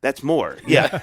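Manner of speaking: suddenly loud, laughing agreement; laughing outburst. Real, not acted. Agreeing with his friend's joke, loud laughing burst. Joking that extra trip is more.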